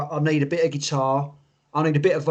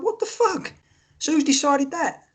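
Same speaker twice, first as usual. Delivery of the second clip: high-pitched, baffled outrage; retold incredulity; 10 semitones higher than usual. Fan retelling the singer's monitor trouble, incredulous.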